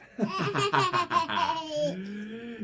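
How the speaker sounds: high, loud hearty laugh; big laugh. Acted. Sad principal finally bursts into loud laughter. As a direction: let out a full belly laugh. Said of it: "Mister Lowe began to laugh".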